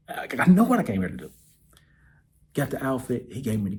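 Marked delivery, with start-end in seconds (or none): 0.1-1.3 louder and faster. Real, not acted. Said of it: "repeats faster".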